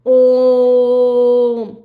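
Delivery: ओम with a loud, long-sustained ओ on one note; extra-long vowel. Shown as a demonstration. Teacher demonstrates pluta vowel to students, ओम prolonged. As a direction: hold ओम for triple length. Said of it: "दीर्घ से भी ज़्यादा लगा प्लुत को".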